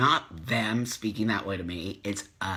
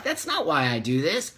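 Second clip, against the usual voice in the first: ordinary talk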